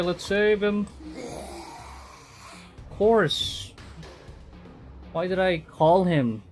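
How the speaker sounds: ordinary delivery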